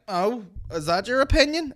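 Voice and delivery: high snide mock question; snide heckler. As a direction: sneer it like a smug heckler. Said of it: "As in, like".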